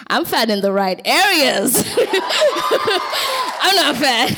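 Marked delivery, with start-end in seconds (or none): 2.0-4.4 laughing out the words. Real, not acted. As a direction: giggle through it. Laughing through the line.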